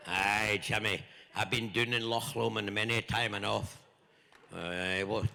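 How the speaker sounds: playful broad Scottish brogue. Pretend. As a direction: ham up a Glaswegian local. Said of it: "I'll translate my Scottish".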